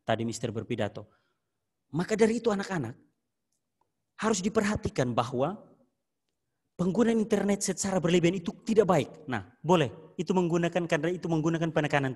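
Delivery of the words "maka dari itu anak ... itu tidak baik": higher, insistent speech-giving voice; firm reminder to the students, words stressed; emphatic warning. Shown as a demonstration. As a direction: press the warning home like a teacher at an assembly. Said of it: "nadanya harus keras".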